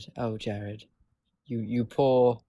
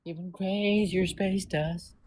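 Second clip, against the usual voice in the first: mocking drawl; 5 semitones higher, slower than usual. Mocking repeat of space dust.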